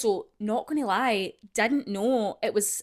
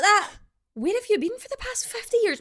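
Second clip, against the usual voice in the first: high incredulous; 10 semitones higher, 3 dB louder than usual. Retold or imitated. Disbelief quote, high pitch.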